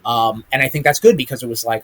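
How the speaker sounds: normal talking voice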